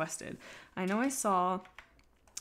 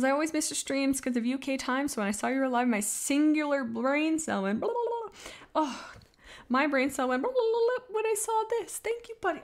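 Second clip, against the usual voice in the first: much higher, louder chirpy read; excited fan voice; 8 semitones higher, 5 dB louder than usual. Pretend. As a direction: read the chat like a giddy fan. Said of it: "It says".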